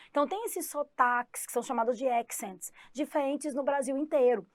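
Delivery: ordinary talk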